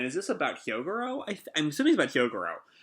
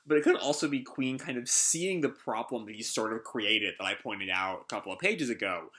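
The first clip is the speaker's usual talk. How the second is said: normal talking voice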